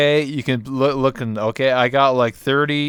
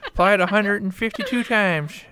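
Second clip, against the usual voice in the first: put-on old man voice; 8 semitones higher than usual. Continuing old man bit.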